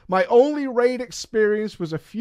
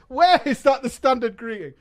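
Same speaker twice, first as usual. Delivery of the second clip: repeated through laughter, higher; laughing; 7 semitones higher, 4 dB louder than usual. Real, not acted. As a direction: streamer repeats the line, laughing it out.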